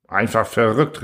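ordinary delivery

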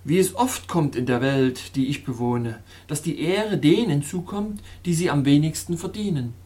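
ordinary delivery

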